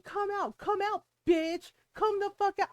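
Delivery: mock yell of the angry neighbor; retold screaming. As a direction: shout it like she's in your driveway. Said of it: "she's screaming".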